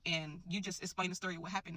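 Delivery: ordinary delivery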